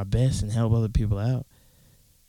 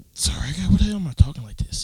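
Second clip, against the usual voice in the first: talking through a burp; words pushed out mid-burp; 6 semitones higher, 3 dB louder than usual. Real, not acted. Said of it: "Sorry, I'm fucking burping".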